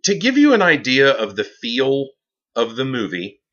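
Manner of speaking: usual speaking voice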